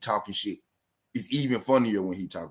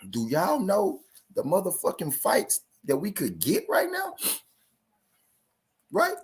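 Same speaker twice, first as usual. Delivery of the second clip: incredulous; 9 semitones higher than usual. Real, not acted. Incredulous rant to viewers.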